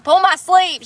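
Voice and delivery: imitated motherly command, loud and high; mama's order. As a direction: call it out like mom from the driver's seat. Imitates mama ordering from driver's seat.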